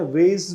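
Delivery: वेज with ay and z, "vayz"; American pronunciation. Shown as a demonstration. Teacher adds a variant, American pronunciation. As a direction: say वेज to rhyme with days. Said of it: "भी बोल देते हैं".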